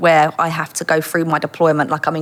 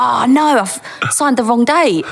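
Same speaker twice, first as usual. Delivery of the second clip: 1 panicked thought; 5 semitones higher, slower than usual. Retold or imitated.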